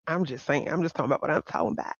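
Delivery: hushed, fast knowing aside; sly aside. Real, not acted. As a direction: mutter it like a sly hint.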